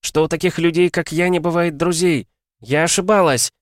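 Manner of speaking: high, loud and heartfelt; impassioned farewell cry; shouted confession. A narrator's reading. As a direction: admit it at the top of the voice. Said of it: "прокричала Миас".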